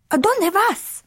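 high-pitched kitten calling in Spanish. A narrator's reading. Skipito to his amigos on the crocodile, calling out. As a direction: call it out high to the passing pack. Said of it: "called out Skipito".

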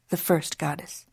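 ordinary delivery

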